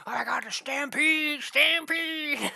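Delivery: very high, loud panicked cry; comic high-pitched shriek of alarm; mock alarm shout. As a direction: yell a hammed-up warning to everyone. Acts cowboys yelling warning of stampede.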